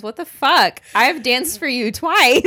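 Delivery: hammed-up baffled husband; mock outraged yell. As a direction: blurt it out in shocked disbelief. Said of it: "And he goes".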